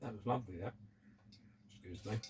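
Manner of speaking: mouth full. Real, not acted. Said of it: "talking with my mouth full".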